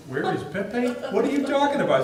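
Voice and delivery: baffled question building in pitch; mock-confused outburst; retold bafflement. Retells the girls' puzzled reply.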